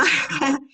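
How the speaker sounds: brief real laugh; a quick amused chuckle; short laugh. Real, not acted. Laughs at her own remark. (risa breve y espontánea)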